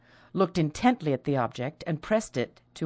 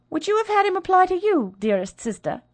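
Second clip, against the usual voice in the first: bitter sarcasm; 5 dB louder than usual. Acted.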